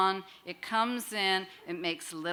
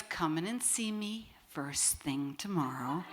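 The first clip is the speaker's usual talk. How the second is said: hushed, breathy instruction; half-whispered advice from the doctor; 3 semitones lower, 9 dB quieter than usual. Retold or imitated. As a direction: whisper it like a late-night phone call. Doctor on the phone at night.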